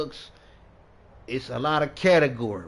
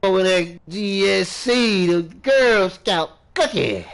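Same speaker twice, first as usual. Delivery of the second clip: higher, loud, stretched-out hype; loud playful boast; 5 semitones higher, 6 dB louder than usual. Pretend. Smoker showing off his strain, loud hammed-up boast. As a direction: crow it out, drawing out the name.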